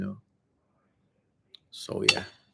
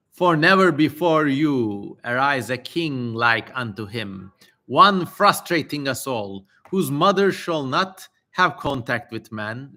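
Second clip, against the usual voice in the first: raised, declaiming scripture voice; solemn reading; 2 semitones higher, 4 dB louder than usual. Acted. Streamer reading the demon's prophecy to chat, solemn declaiming. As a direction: read it like an old holy text. Reads ancient text aloud.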